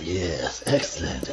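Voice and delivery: low scheming villain voice; spy voice. Acted. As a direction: say it low and pleased, plotting. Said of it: "That's my spy voice".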